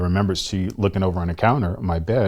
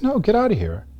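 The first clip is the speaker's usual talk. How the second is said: higher, dismissive big-sister voice. Retold or imitated. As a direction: shoo him away as his sister. Sister refuses him.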